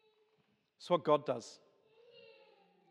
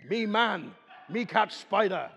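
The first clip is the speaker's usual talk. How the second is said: a hint of a hammed-up caveman, a bit louder; 4 semitones higher, 5 dB louder than usual. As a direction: puff up like a caveman protecting the family. Caveman hero off to catch spider.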